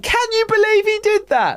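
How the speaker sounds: very high, gushing child impression; hammed-up squeaky amazement; over-the-top excitement. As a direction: imitate an overexcited kid trying to impress. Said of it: "over the top speaking to this other girl".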